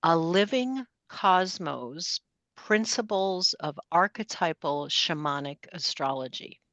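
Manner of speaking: measured, spaced-out reading of a title; slow, deliberate title. Real, not acted. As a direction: spell out the title slowly for listeners. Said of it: "I'll say it slowly".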